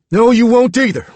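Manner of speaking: loud, high defiant reply. Acted. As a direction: refuse to back down. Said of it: "Roop said".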